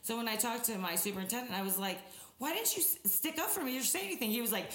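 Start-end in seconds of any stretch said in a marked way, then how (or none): 2.4-4.3 high, indignant reenacted protest; put-out high-pitched questioning; retold indignant complaint. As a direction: replay the complaint to a boss, pitched way up. Retelling her complaint to superintendent.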